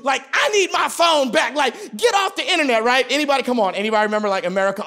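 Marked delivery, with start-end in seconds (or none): 0.3-1.6 raised, loud mom voice; mock shout of an impatient mother; demanding mama. Mama barging into your room for the phone, demanding. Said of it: "your mama coming into your room". 2.0-2.8 high-pitched mock yell; demanding mama. Mama to kid hogging the phone line, demanding. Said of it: "your mama coming into your room".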